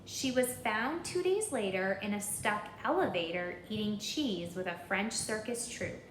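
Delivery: usual speaking voice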